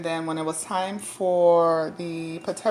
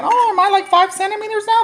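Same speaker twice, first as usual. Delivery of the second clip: high, loud, fast hopeful ask; eager for good news; hopeful question; 12 semitones higher, 5 dB louder, faster than usual. Retold or imitated.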